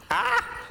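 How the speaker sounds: startled yelp. Real, not acted. Startled by the game.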